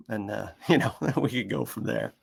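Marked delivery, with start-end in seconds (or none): none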